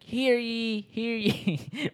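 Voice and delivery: high, announcing town crier call. Retold or imitated. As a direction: call out like a colonial herald. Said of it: "ringing bells, like".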